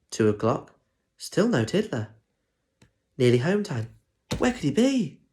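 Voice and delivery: usual speaking voice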